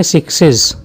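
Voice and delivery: सिक्सेस said as two clear syllables, siks-ez; extra syllable. Shown as a demonstration. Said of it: "ईएस लगाने पर एक syllable मिल जाएगा".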